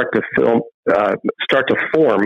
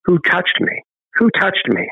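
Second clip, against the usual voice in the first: lightly voiced Jesus, higher, calling out to the crowd; a hint of Jesus searching the crowd; searching question; 7 semitones higher than usual. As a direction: ask it again and again, looking around. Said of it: "he's looking around asking".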